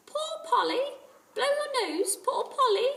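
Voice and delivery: high, small, sad squawk; a pitiful parrot begging at the door. A narrator's reading. Kiki the parrot outside the door, small and sad.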